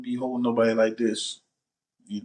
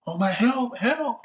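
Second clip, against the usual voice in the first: high mock cry for help; acted freak-out; 12 semitones higher, slower than usual. Caught on camera with a choking girl, pretend panic. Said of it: "I'm freaking out".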